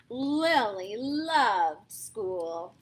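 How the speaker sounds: very slow, drawn-out opening; slow storyteller. A narrator's reading. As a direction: savor each word.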